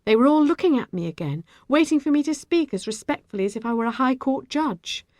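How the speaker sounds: usual speaking voice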